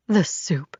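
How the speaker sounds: dawning horror, whispered; barely voiced realization. A narrator's reading. Realizes she was poisoned.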